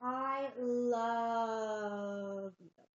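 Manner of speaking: drawn-out gush. Acted. Drawn out gushing.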